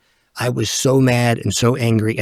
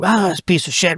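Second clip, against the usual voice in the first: put-on old angry outburst; 6 semitones higher than usual. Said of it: "that would have been a huge issue".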